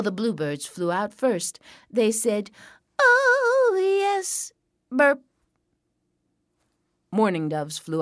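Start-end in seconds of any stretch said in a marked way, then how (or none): 3.0-4.5 very high, drawn-out "oh yes"; tiny satisfied bird voice; contented bluebirds. A narrator's reading. Stuffed bluebirds fly out. 4.9-5.2 short high spoken burp; dainty bird belch; little burp. A narrator's reading. Full bluebirds burp.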